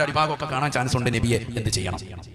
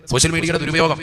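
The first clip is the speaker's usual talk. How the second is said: ordinary delivery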